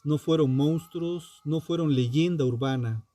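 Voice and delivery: ordinary delivery, nothing marked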